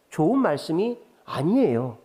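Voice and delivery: normal talking voice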